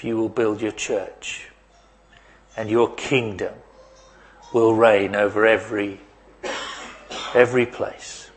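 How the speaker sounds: congregational prayer. Acted. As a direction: pray it gently over the congregation. Pastor prays aloud.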